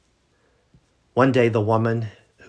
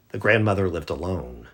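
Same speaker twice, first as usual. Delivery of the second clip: ordinary delivery, nothing marked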